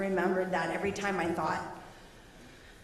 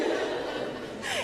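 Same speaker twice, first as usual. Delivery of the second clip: high breathy giggle; breathy high laugh. Real, not acted. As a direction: let out an airless high giggle. Laughs mid-story.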